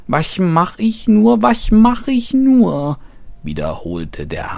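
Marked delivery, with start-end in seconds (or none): 0.1-3.0 high, loud, frightened wailing; a little hare panicking, near tears; trembling panic. A narrator's reading. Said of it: "zitterte wie Gras im Wind".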